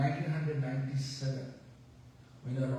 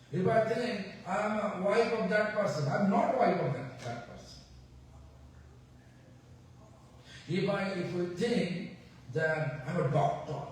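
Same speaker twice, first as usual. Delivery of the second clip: louder, higher preaching with the word not hammered; full-force preaching; 6 semitones higher, 4 dB louder than usual. Acted.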